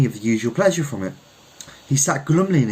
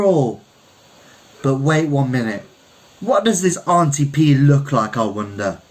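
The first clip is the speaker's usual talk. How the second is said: higher, louder playful Caribbean man; Kelso teasing; 5 semitones higher, 3 dB louder than usual. A narrator's reading. Kelso teasing his fiancée about her strength, playful and louder. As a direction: tease her with big mock admiration.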